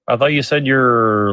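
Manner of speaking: ordinary talk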